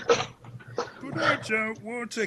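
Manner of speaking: put-on high, slow childish whine; whiny refusal. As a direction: drag it out like a sulky kid. [name] whines.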